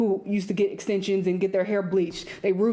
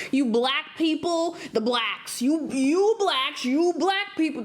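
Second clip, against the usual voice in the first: mock supremacist; 5 semitones higher, slower than usual. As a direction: sneer it like a bigot. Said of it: "that's how they talk".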